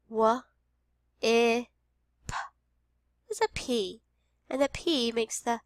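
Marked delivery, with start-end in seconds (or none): 0.1-2.3 "W, I, p" apart with pauses; sounded out. Shown as a demonstration. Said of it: "Our last sound".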